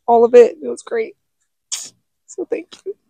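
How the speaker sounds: fighting back real tears; choked up. Real, not acted. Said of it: "dang it, I'm getting sad".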